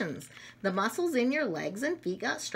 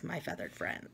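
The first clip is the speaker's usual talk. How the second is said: whisper; 6 semitones lower, 12 dB quieter than usual. Acted. Answer to the bird.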